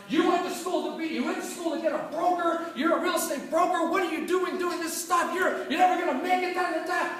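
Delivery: mock the dad's tirade; angry dad. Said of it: "he started cussing me up and down".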